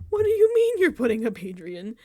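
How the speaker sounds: loud indignant demand; indignant outburst. Retold or imitated. Houseguest confronting Lauren about putting up Adrian, loud and indignant. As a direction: fire the question at her, outraged.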